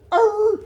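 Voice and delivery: high yelping bark; imitated dog bark. A hunting dog baying while chasing the bear. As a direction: bark like a hound on a trail. Dogs barking on the bear chase.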